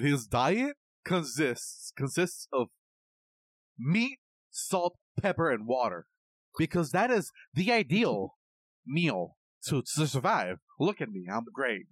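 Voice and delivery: hammed-up pundit with swooping pitch; Jordan Peterson. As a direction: lecture in a mock professor voice, leaping up on key words. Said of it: "he really talks like that".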